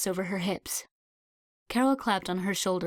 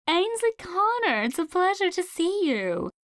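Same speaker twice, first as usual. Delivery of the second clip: put-on high, loud cheery old lady voice; sweet old lady; 11 semitones higher, 4 dB louder than usual. A narrator's reading. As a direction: greet her with a bright sing-song. Old woman greets her warmly.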